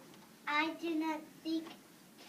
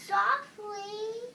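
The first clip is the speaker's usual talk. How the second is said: higher, louder startled question; incredulous echo; 5 semitones higher, 7 dB louder than usual. Acted. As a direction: throw the word back, affronted and rising. Startled echo of friend's suggestion.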